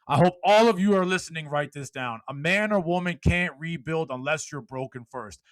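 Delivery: loud, high motivational speech; fired-up preaching. Acted. Speaker rallying podcast listeners, loud preaching. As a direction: preach it to the whole room.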